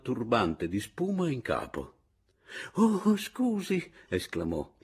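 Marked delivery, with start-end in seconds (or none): 2.7-3.9 high, drawn-out apologetic exclamation; startled old man, voice leaping up high; flustered old man. Acted. Half-dressed old man to an unexpected visitor, flustered apology.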